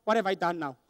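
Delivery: subdued, in-trouble undertone; dreading inner thought. Acted. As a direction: voice the summoned kid's private worry. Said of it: "the tone was".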